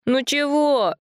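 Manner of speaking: grumbling sleepy question; high lightly voiced sleepy kid; sleepy boy. A narrator's reading. Sleepy brother woken.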